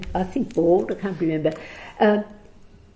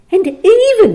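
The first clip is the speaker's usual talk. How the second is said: climbing pitch and louder, building excitement; voice going higher; 14 semitones higher, 11 dB louder than usual. Retold or imitated. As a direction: let the voice jump up eagerly. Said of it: "his voice going higher".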